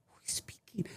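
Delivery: whispered question, retold; very soft, whispery wondering; hushed wonder. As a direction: ask it under your breath, intrigued. Retells wondering at unseen speaker.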